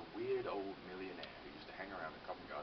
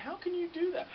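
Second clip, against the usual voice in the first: incredulous; 8 dB louder than usual. Retold or imitated. Bafflement at sleeping woman.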